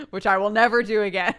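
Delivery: loud, breaking into laughter; real laughing self-mockery; laughing vow. Real, not acted. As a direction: say it loudly on the edge of a laugh. Laughs at own bad accent attempt.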